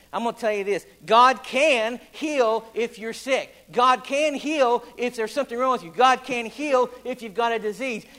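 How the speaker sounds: full-force revival preaching. Acted. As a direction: preach it out to the congregation. Preacher in full sermon delivery.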